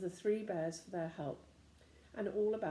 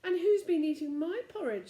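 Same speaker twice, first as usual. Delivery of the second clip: mother bear voice; 10 semitones higher, 9 dB louder than usual. A narrator's reading.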